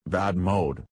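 normal talking voice

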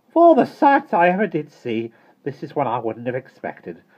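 high, loud, scandalized; disgusted. A narrator's reading. Miss Tartlett gazing at waving feet, disgusted. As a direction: exclaim in deep disgust. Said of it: "with an expression of deep disgust".

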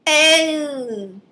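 high, loud "Oh" from the neighbor; a hint of a snooty neighbor; lightly mocking neighbor. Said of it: "She said".

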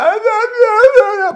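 high, loud whiny grunts; child whining. Retold or imitated. Pastor imitating his son whining for coke, high whine. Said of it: "Sebastian coming there whining".

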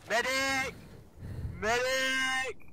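loud, high call for a medic; man down shout. Acted. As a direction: yell for help across the field. Teammate down, calling for medic.

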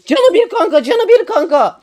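high, loud imitation of a panicked enemy; hammed-up high yell of a panicking enemy; mock yell. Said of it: "diye bağırıyor".

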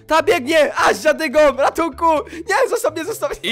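mock panic. Real, not acted. Chased by old man mob.

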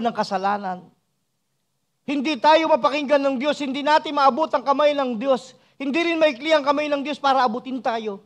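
high, insistent pulpit voice; full-force preaching. Real, not acted. Preacher warning that sins cut people off from God, full-force preaching.

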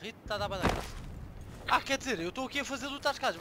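ordinary talk, nothing marked

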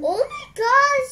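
high, loud, drawn-out gasp of shock; sour shock. Real, not acted. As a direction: react to the sour hit. First bite of extreme sour candy.

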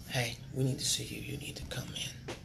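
a soft, unvoiced whisper of bad news; hushed doctor's call. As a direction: whisper it like grave news on the phone.

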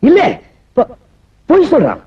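high, stammered burst; angry outburst. Acted. Accused man shouting in court that the witness lies. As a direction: blurt it out in protest.

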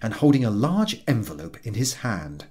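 ordinary delivery, nothing marked

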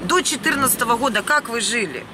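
ordinary talk

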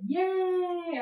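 loud, high happy yay; excited cheer. Real, not acted. Vlogger cheering to viewers about starting again, loud and high. Cheering after restarting the videos.

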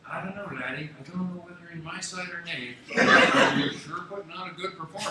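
a hint of an Irish accent, laughing; Irish lilt. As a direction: lightly voice the Irish politician, amused. Voicing the Irish parliamentarian.

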